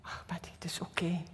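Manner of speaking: soft whisper, very gentle; tender God voice. Retold or imitated. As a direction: reassure her tenderly in a whisper. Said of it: "Zei God zo lief".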